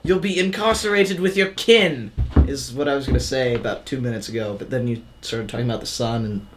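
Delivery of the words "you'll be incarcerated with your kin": put-on slow, soft, high British accent; mock British accent. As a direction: trail the joke out in a British accent. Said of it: "Did we do accents".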